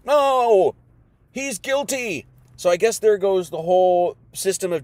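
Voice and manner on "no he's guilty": mock mob shout. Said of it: "the mob's like".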